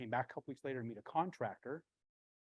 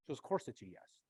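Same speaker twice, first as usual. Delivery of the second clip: normal talking voice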